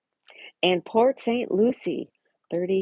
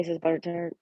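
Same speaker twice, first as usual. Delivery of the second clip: ordinary talk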